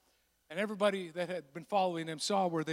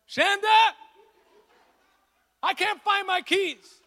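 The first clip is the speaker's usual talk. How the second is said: high, loud helpless husband's whine; whiny husband; 12 semitones higher, 8 dB louder, slower than usual. Retold or imitated. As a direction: whine to the wife like a lost boy. Husband whining to wife.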